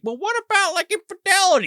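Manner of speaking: high-pitched mock priest; mock priest probing. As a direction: pipe up high, prodding the penitent. Acts out priest probing in confession.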